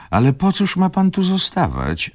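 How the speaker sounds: high-pitched, pleading exclamation; exclaimed protest. A narrator's reading. Izabela urging a man not to stay, high exclamation. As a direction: cry it out high, as a protesting question. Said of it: "zawołała panna Izabela".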